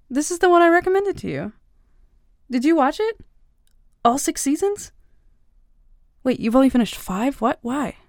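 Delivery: thrilled, rushing to ask; delighted fan checking in; excited. Acted. Excited about recommended show.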